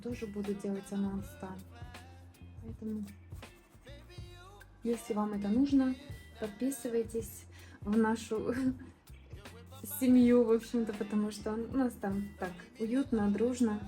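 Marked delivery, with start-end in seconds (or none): none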